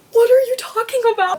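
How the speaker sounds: high, loud disbelieving demand; high-pitched exasperated "what are you talking about"; exasperated outburst. Retold or imitated. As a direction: throw the question at him in disbelief. Exasperated reaction to BJ.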